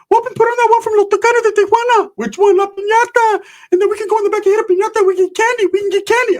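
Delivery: put-on hyped-up childish voice; mock giddy shout; excited squeaky kid. Voicing Ricky excited.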